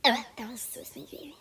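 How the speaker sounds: whisper. Acted. Sneaky aside after customer leaves.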